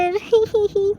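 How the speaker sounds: high little hee-hee giggle; a toddler giggling at the grown-ups; childish giggle. A narrator's reading. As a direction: giggle like a small kid.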